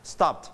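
"stopped" as one syllable with a light t; softened final t. Shown as a demonstration. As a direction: barely release the t in "stopped". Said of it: "มีเธอเบาๆ". ("stopped" พยางค์เดียว เสียงเทอะเบาๆ)

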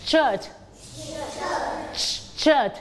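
"shirt" with SH onset and sounded T; teacher drilling "shirt", falling, T released; SH and final T. Shown as a demonstration. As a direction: finish "shirt" on an audible T. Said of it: "อย่าลืมออกเสียง letter T ด้วย".

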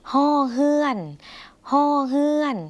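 ฮ้อเฮือน high and sustained, both parts on H; Lao letter name. Shown as a demonstration. Teacher naming the letter Lao uses, high ฮ้อเฮือน. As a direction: sound out ฮ้อเฮือน high-pitched with a clear H. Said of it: "ภาษาลาวมักจะใช้".

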